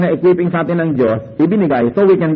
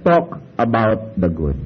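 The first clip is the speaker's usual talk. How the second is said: slow quiet motto; 6 semitones lower, 4 dB quieter, much slower than usual. Shown as a demonstration. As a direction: talk about the good said slowly and softly, with a falling end. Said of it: "Kaya sabi".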